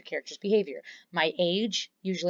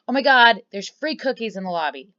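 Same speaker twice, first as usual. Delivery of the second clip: mock excited bribe; 3 semitones higher, 7 dB louder than usual. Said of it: "I tell her".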